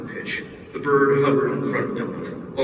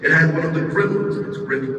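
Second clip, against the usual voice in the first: usual speaking voice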